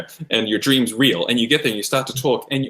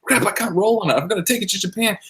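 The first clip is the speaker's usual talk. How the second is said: higher-pitched retold panic; mock panic; 8 semitones higher than usual. Half-asleep fan worrying about his model pieces, mock panic. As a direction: squeak out a dream panic. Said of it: "And I'm like".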